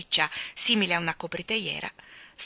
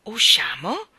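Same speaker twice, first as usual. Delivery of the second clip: can't believe their ears; children echoing the news in amazement; surprised question; 7 dB louder, slower than usual. A narrator's reading. Children echo in disbelief.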